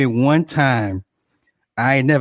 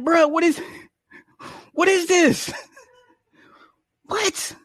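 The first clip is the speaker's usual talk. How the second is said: much higher, baffled; stunned disbelief. Retold or imitated. Husband confronting wife about the snack, high baffled. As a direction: retell the shock. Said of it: "I'm like".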